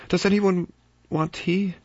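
high, slow, brittle politeness; tense hostess voice; Vera's strained offer. Acted. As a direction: force normality into the silence. Vera breaks tense silence.

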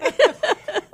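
a high burst of laughter; bright laugh. Real, not acted. Laughing about the guest's age.

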